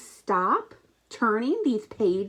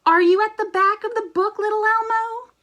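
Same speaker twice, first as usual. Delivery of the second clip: eager call; 9 semitones higher, 4 dB louder, much faster than usual. Acted. Grover calls across the book.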